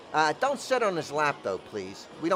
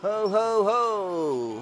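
jolly ho ho ho; 4 semitones higher, much slower than usual. Acted. Mall Santa greeting.

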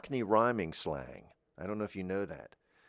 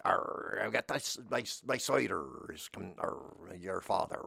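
mumbled mock pirate growl with arrs; a lightly voiced pirate grumbling; pirate accent. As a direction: ham up a pirate accent a little. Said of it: "talk the way that we like".

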